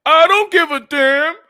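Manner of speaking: loud, slow mock fan outburst. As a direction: bellow it like a careless fan. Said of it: "Some of y'all like that".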